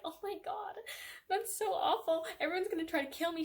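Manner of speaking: high-pitched, hammed-up wail of dismay; mock despair. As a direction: imitate a melodramatic heroine in despair. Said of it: "She's, like, in a depressive state".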